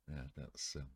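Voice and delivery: normal talking voice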